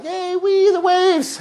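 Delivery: high, bright whoop at the waves; high-pitched playful wee; gleeful squeal. Retold or imitated. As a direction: squeal like a kid having fun. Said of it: "you're like".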